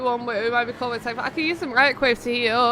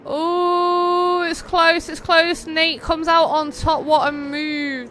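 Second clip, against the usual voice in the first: higher and louder, building on "close"; excited finish call; 4 semitones higher, 4 dB louder, much slower than usual. Real, not acted. Caster calling a close finish between Nate and Fred, excited.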